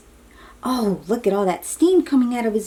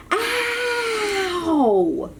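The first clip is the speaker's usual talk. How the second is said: shout of pain; 12 semitones higher than usual. Acted. Ryan crying out on the bridge, high and pained. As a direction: cry out as the hot grip hurts. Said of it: "Ryan is shouting in pain".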